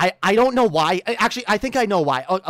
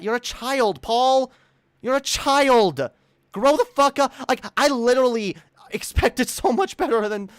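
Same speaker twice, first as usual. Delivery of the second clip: higher, exasperated telling-off; scold, drawing out the insult; angry scolding; 5 semitones higher than usual. Real, not acted. Streamer tells Paul to grow up, angry scolding.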